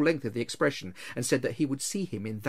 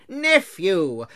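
loud, forceful rebuke; stern; 6 semitones higher, 7 dB louder than usual. A narrator's reading. Uncle answering his pleading nephew, stern. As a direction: cut him off sternly. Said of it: "returned the uncle sternly".

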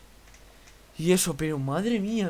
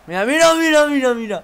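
high loud urgent calls; excited shouting; 9 semitones higher, 15 dB louder than usual. Real, not acted. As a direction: shout it at the screen. Watching a great play.

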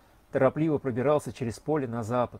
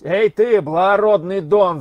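higher, much louder hail; shouting; 6 semitones higher, 9 dB louder than usual. Acted. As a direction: call out roughly to stop the rider. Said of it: "закричал один".

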